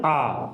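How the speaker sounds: आ drawn out, open and clear; model vowel. Shown as a demonstration. Teacher to students, modelling आ clearly. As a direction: hold आ long for learners. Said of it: "इसको बोलते हैं".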